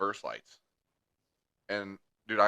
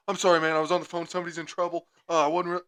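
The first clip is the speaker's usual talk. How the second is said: higher, louder imitation of excuses; flustered apologizer; 9 semitones higher, 5 dB louder, faster than usual. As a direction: imitate a driver pleading excuses in a rush. Imitates the apologetic other driver.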